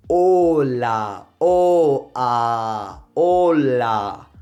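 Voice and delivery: long "Hola" with steady o and a, silent h; native-like pure vowels. Shown as a demonstration. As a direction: hold "o" and "a" without any glide. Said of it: "you'll sound even more native".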